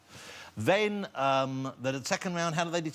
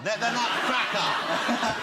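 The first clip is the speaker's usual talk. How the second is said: laughing; 6 semitones higher, much slower than usual. Retold or imitated. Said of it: "It was let the nut see the cracker".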